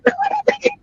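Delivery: a high shrieking laugh; squealing laugh. Real, not acted. Loud laugh.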